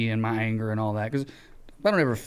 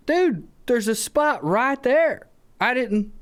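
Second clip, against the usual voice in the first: exasperated; 10 semitones higher, much slower than usual. Retold or imitated. Arguing with the driver.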